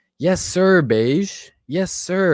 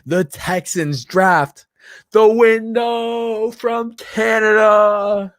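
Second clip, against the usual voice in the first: slow, high, stately draft-pick announcement; draft announcer; 8 semitones higher, much slower than usual. Acted. Streamer reads a fan's joke as a slow, high draft announcer. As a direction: announce it like the commissioner at the podium. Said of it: "Cyanide says".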